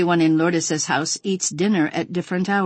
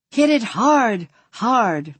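higher, louder encouraging cheer; 5 semitones higher, 3 dB louder, slower than usual. A narrator's reading. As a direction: egg the child on with excitement. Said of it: "Maria Isabel cheers her on".

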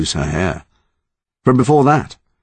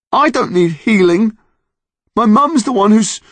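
put-on upset boy voice; 5 dB louder than usual. Boy protests, breaks off.

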